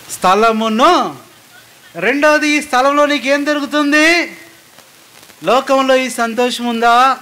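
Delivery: high, loud, slow sermon delivery; preaching at full force. Acted. Preacher pressing the congregation about joy, at full force. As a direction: pound out each line from the pulpit.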